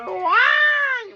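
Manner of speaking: long high squeal. Real, not acted. Baby on camera shrieking high and slow.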